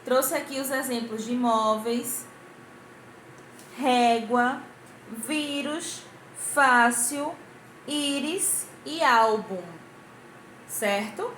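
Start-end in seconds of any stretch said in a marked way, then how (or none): 3.8-9.6 word list with "régua, vírus, fácil, íris, álbum" each hit on the first syllable; stressed penultimate syllables. Shown as a demonstration. Teacher showing pupils paroxytones, first syllable of "régua, vírus, fácil, íris, álbum" stressed. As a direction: press on the next-to-last syllable of "régua, vírus, fácil, íris, álbum", pausing between words. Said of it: "a sílaba mais forte da palavra".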